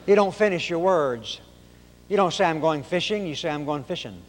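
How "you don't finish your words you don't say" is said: ordinary talk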